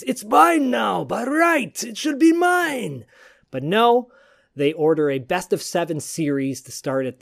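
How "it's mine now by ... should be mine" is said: high, slow, shouted boast; Nikita's gloating claim. Retold or imitated. Nikita gloating that the stripped title is his, high shouted boast. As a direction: claim the belt like a cocky heel. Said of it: "Nikita thinks".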